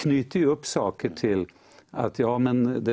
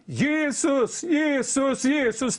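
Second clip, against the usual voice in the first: high, laughing mock cry of Jesus; imitated plea from the ditch; retold desperate cry; 13 semitones higher, much slower than usual. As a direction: call out the name, half laughing. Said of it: "Och så kom det".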